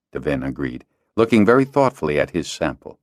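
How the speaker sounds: usual speaking voice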